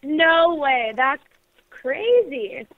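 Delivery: ordinary talk, nothing marked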